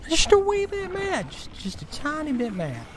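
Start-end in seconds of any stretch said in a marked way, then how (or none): 0.1-1.3 high squeaky voice, pretending to be barely bothered; cutesy high falsetto understatement; squeaky mock calm. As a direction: play down the rage in a silly high voice. Frustrated gamer mocks own anger.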